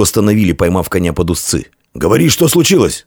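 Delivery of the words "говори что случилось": high-pitched, urgent order to speak; shouted demand. A narrator's reading. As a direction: demand the news from the messenger. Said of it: "закричал сотник".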